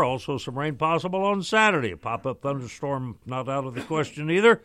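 brisk broadcast forecast voice; radio weather read. Acted.

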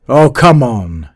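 loud, slow impatient cry; cried out. A narrator's reading. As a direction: call it out hard and slow. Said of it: "he cried at last".

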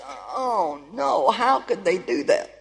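soft, hurt disbelief; a quiet wounded oh no; remembering the pain, softly; hurt dismay. Retold or imitated. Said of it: "and I just".

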